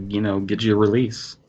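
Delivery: usual speaking voice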